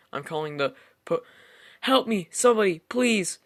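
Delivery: breekt af en schreeuwt hoger en luider om hulp; panicked cry for help. Acted. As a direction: veel hoger en luider, in paniek. Cut off, crying for help.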